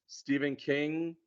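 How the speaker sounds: usual speaking voice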